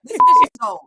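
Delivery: shrieked high praise; hype. Real, not acted. Hyping up her friend, high shrill praise.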